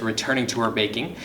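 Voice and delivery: plain reading voice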